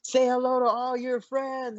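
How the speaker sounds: high, slow, playful filming voice; mock the sulking fighter for the camera; teasing coach. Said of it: "he's just like".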